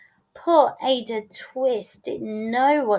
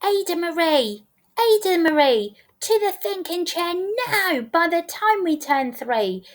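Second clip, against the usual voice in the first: high, insistent telling-off; exasperated parent laying down the law; parental scolding; 7 semitones higher than usual. A narrator's reading. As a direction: call the child out by full name, pitched high. Parents scold and send child away.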